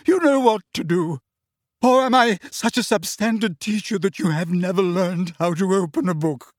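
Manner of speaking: high and loud, barely holding together; sarcastic, wounded teacher on the edge; high-pitched hysterical. A narrator's reading. Trelawney lashing out at her class, high and hysterical. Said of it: "her voice high-pitched and somewhat hysterical".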